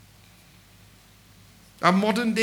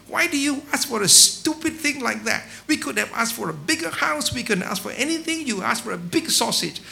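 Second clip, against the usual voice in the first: scolding wife; 4 semitones higher than usual. Acted. Wife scolds husband for wishing a sausage, exasperated. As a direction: berate him for wasting a wish.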